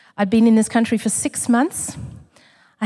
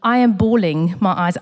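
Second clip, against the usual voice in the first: normal talking voice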